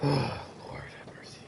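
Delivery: slow whispered plea; weary whisper. Real, not acted. Streamer in disbelief at the trailer, slow whisper. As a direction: breathe it out slowly, whispered. Stunned after seeing game clips.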